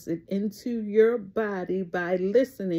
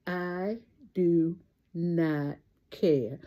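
each word spaced, falling on care; flat refusal laid down slowly; slow deliberate catchphrase; 2 semitones lower, much slower than usual. Real, not acted. Vlogger answering the rent request, slow refusal.

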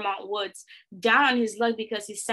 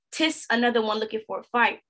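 ordinary delivery, nothing marked

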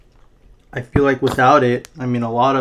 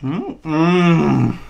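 humming through chewed food; mouth full, chewing; 4 semitones higher than usual. Real, not acted. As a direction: lips closed around food. Seen 2: Cheeks puffed, lips closed, chewing slider and fries while humming.

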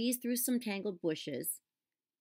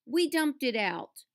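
yelled; 7 semitones higher, 7 dB louder, slower than usual. A narrator's reading. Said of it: "yelled Mouse".

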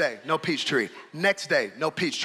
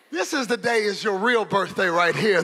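usual speaking voice

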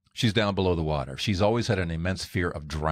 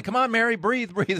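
high, urgent call to breathe; rescuers yelling. Retold or imitated. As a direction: urge her to breathe, pitched up. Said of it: "she heard the people yelling".

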